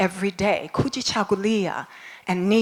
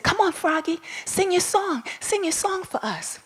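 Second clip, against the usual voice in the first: light, high coaxing voice; coaxing stars; 9 semitones higher, 5 dB quieter than usual. Acted. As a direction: sweetly urge the frog to sing. Stars coaxing Froggy in story.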